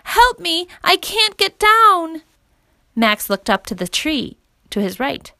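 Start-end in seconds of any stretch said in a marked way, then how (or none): 0.0-2.2 put-on high-pitched stranded girl; acted panicked child calling for rescue; cry for help. A narrator's reading. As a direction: wail it slowly at the end. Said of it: "He heard it again".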